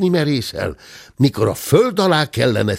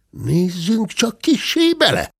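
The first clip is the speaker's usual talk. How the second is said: a higher, curious gentleman's voice; lightly voiced gentleman; 11 semitones higher than usual. A narrator's reading. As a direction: peek inside with mild curiosity. (kíváncsi úr hangja)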